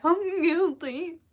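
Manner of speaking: high, drawn-out squeaky confession; mock voice crack. Shown as a demonstration. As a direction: exaggerate the crack in a slow, high voice. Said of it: "Might you hear my voice crack?".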